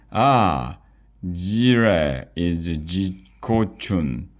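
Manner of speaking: slow, put-on French accent; exaggerated French chef voice. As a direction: play the excited French chef rushing in. Chef bursts into the kitchen.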